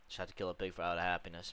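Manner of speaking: usual speaking voice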